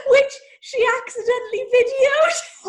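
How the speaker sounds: high, loud laugh-shout; delighted laughing shout over a story. Real, not acted. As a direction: shriek it through laughter. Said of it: "laughing at the story".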